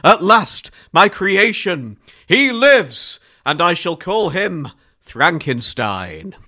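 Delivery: high, loud, slow triumphant cry; mad scientist. Acted. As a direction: crow over your creation, slow and loud.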